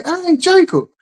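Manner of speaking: mother calling her son from the next room. Retold or imitated. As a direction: call the name across the house. Mama calling kid from another room.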